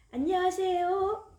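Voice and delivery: high sing-song hello; child greeting the mailman; cheerful greeting. Acted. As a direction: greet brightly with a rising tune.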